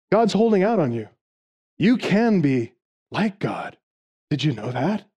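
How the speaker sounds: preacher lightly voicing Satan confiding to Eve; Satan as insider. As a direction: lure her in like a conspirator sharing secrets. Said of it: "he takes the role of an informed insider".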